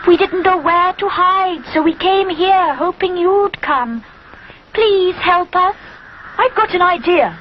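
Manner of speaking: high, pleading little bird; cuckoo voice. A narrator's reading. Cuckoo asking Rupert for help, pleading. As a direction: beg like a frightened small bird.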